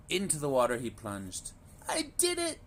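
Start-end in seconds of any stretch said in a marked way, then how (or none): 1.9-2.6 very high joyful cry; the littlest duck proud at last; triumphant duckling. A narrator's reading. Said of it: "he cried".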